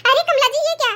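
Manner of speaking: catty neighbour cackling at the outfit; mocking neighbour Mrs Sharma. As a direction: tease her and laugh at her dress. Neighbour mocks the new dress.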